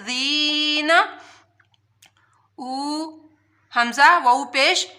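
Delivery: normal talking voice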